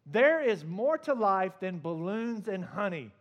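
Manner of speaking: loud, slow, fed-up outburst; exasperated Christopher Robin. Retold or imitated. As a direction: snap at Pooh in exasperation, stretched out. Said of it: "he looks at him and he says".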